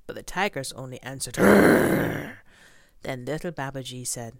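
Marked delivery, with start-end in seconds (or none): 1.4-2.4 loud angry growl; loud tiger growl. Acted. Tigers answer.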